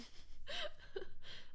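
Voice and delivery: high burst of laughter. Real, not acted. Laughing at her own joke.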